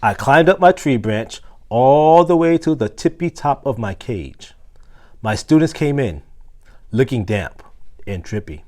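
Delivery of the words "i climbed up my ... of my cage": usual speaking voice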